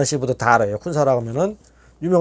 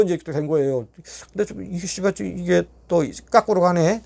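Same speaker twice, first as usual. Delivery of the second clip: ordinary talk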